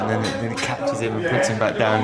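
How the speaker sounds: ordinary talk, nothing marked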